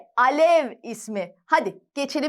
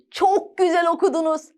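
usual speaking voice

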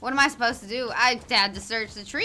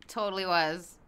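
usual speaking voice

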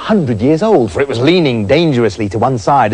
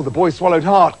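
usual speaking voice

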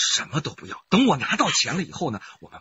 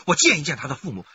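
usual speaking voice

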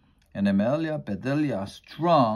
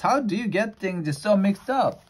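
higher exasperated cry; exasperated exclaim; 9 semitones higher than usual. Acted. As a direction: throw up your hands in exasperation. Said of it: "Exclaimed Missus Rogers".

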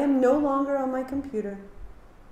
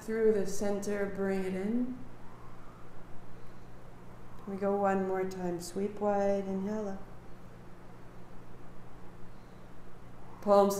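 guided yoga cueing; 5 dB quieter than usual. Acted. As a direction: guide the class gently through the movement. Guiding breath and movement.

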